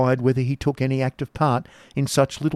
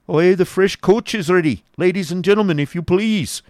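high, loud announcement; coach driver calling; 10 semitones higher, 4 dB louder than usual. Acted. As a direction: call out to the passengers, pitched up. New driver calls the passengers.

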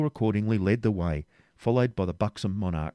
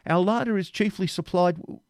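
embarrassed halt; 9 semitones higher than usual. A narrator's reading. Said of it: "he stopped, and was somewhat embarrassed".

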